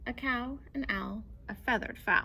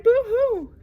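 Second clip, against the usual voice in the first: high loud boo-hoo; crying rat; 12 semitones higher, 14 dB louder than usual. Acted. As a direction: wail it like a scared rat. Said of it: "who cried".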